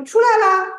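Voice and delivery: high, loud imitated call. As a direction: call it out as if summoning someone. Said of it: "召唤了他".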